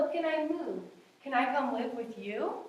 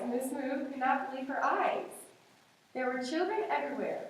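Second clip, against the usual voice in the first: ordinary delivery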